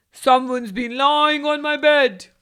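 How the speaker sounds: loud roaring papa bear; booming Father Bear roar. A narrator's reading. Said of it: "he roared".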